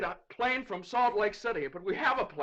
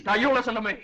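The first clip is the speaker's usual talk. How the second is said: angry retort; 3 semitones higher, 6 dB louder, faster than usual. Acted. Father angrily cuts off judge.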